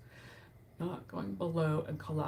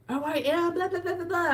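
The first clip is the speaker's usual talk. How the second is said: high, mocking talker voice; mock chatterbox; 11 semitones higher, 4 dB louder than usual. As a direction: parody the nonstop talker. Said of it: "she just talked nonstop".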